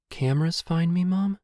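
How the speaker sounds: high, airy stranger; eerie high questioning; listless voice. A narrator's reading. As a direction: ask in a thin, high, listless voice. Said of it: "says the listless voice".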